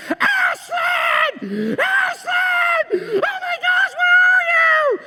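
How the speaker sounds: frantic, very high-pitched hammed-up scream; madman screaming. Dad searching Disney for lost daughter, hammed-up screaming. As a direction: shriek it like a panicking parent. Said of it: "I'm screaming. I look like a madman".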